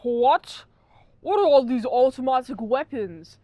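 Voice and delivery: loud, badly faked Scottish accent; Sean Connery. Reviewer redoing the character's line about guns, loud mock Scottish accent. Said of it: "do it in his accent".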